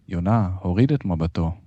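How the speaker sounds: ordinary delivery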